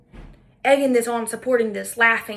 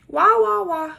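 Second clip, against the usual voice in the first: mock whining; 7 semitones higher, 4 dB louder, slower than usual. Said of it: "Typical woman complaining".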